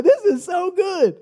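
high, drawn-out mock delight; retold delight. Preacher recalls hearing a young man's prayer, delighted. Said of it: "I just chuckled".

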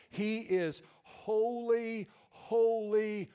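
high, loud, drawn-out holy; slow ringing proclamation. Acted. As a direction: stretch each holy out and up. Preacher building on holiness.

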